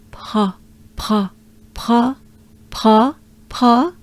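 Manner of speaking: "Pa" as one syllable with breathed French R; P-R linked. Shown as a demonstration. Teacher drills it together with learners, P-R linked. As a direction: run P into the R on each "Pa". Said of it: "try it together ensemble".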